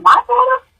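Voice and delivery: very high stunned question, retold; high, incredulous echo of the news; disbelief. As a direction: voice shoots up, unable to take it in. Retelling her disbelieving questions at news.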